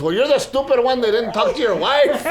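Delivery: high, loud teasing comeback; Pollito. Retold or imitated. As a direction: fire back in mock indignation, laughing.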